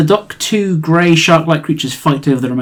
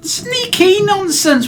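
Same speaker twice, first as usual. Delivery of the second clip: squeaky high, slow scoff; high-pitched dismissive sailor; high mocking NPC; much slower than usual.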